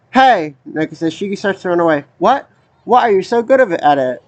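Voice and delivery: low, even voice reading the characters' lines; reading game dialogue. Acted. Reads game dialogue aloud.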